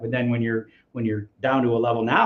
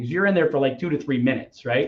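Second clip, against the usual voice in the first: ordinary talk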